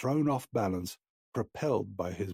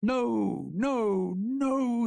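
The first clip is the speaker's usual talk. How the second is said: high, loud, sustained wail; crying no; 14 semitones higher, 5 dB louder, much slower than usual. A narrator's reading. Boy fleeing the horror, wailing no. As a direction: wail each no on a held high note. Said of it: "he continued".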